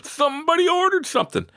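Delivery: high squeaky delight at an order; thrilled high-pitched squeal; excited squeal. Retold or imitated. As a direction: squeal it like big news. Excited memory.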